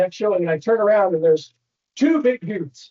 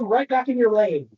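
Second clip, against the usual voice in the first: usual speaking voice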